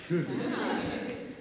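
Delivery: quiet embarrassed giggle; soft breathy laugh. Real, not acted. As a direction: laugh it off softly. Laughing at her own confession.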